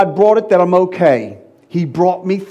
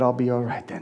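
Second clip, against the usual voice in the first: soft low farewell; tender reassurance; 5 semitones lower, 9 dB quieter, faster than usual. Retold or imitated. Son telling his dying mother he will be fine, soft and low. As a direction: breathe it softly to her.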